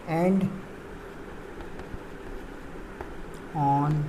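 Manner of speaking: low, very soft, halting read-out; slow dictation. Real, not acted. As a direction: say it softly to yourself, word by word, with long pauses. Murmurs definition aloud, likely while writing.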